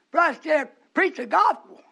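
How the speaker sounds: imitated urging. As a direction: mimic an insistent church member.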